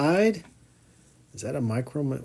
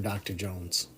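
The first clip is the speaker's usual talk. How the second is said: hushed echo; 6 semitones lower, 11 dB quieter than usual. Retold or imitated. Quote repeated, hushed echo.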